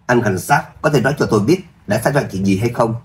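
usual speaking voice

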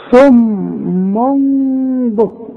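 very slow "صم بكم", long hum on the m; tanween turned to meem. Shown as a demonstration. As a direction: hold a hidden m from "صم" into "بكم". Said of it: "اقلب التنوين ده الى ميم". ("صم بكم" بقلب التنوين ميم مع الغنة)

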